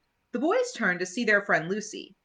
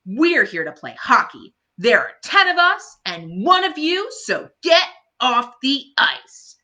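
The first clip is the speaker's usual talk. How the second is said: louder, higher taunting voice, slowing on the end; bullying older kid; 5 semitones higher, 6 dB louder than usual. Acted. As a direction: push a small kid around. Bully kids threatening Patty.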